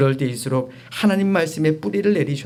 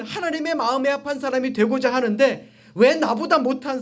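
raised, protesting plea to God; believer's complaint; 9 semitones higher than usual. Acted. Believer asking God why, imitated complaint.

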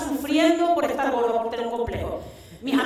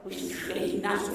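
soft, low, breathy awe; awed hush; 7 semitones lower, 10 dB quieter than usual. Retold or imitated. Awed slow-motion gym entrance.

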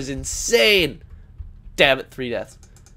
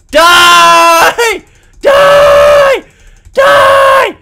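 screaming high and loud; loud shout; 11 dB louder, much slower than usual. Real, not acted. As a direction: yell it at the enemy. Gamer attacking, shouting.

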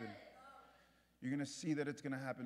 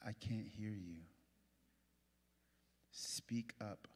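slow and softer, with a whispered "speak up"; soft straining voice; 5 semitones lower, slower than usual. Shown as a demonstration. Preacher acting a listener straining to hear, slow and soft. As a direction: lean in and ask quietly, almost whispering. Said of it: "they talk super soft like this".

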